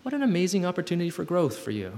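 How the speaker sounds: a hint of ironic enthusiasm; mock upbeat reframe. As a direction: sell the silver lining with a grin. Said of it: "I'm like".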